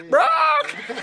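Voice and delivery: high, piercing bird squawk; parrot squawk. Pretend. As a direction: shriek it like a warning bird. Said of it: "Like a bird that warns of danger".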